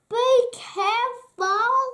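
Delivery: squeaky puppet chorus warning. Acted. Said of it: "warned the other puppets".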